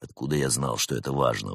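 quietly affronted agent; low indignation. A narrator's reading. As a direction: object in a low, controlled voice. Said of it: "возмутился брат Немрод".

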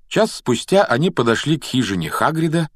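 ordinary delivery, nothing marked

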